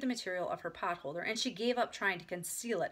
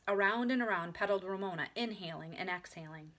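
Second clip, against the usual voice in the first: usual speaking voice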